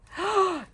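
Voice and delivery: high-pitched intake of breath; delighted gasp. Real, not acted. Admiring the cute gift.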